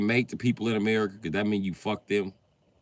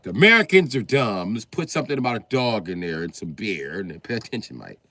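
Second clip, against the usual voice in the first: put-on British accent, starting loud then easing; mock British; 3 dB louder than usual. British man plotting how to reach Americans, mock British accent. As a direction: scheme aloud as a Briton. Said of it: "I think the British just be like".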